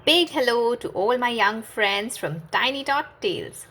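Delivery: put-on slow, sing-song welcome to children; cheery kids' host. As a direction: greet the little ones brightly and slowly. Host greets young listeners.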